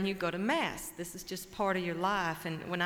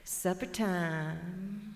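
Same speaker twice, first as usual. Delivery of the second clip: very slow, savoring supper time; drawn-out punchline; much slower than usual. Acted. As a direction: let it out slowly like a satisfied grin. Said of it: "And then Buzzard said".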